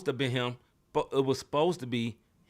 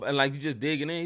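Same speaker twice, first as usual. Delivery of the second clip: ordinary delivery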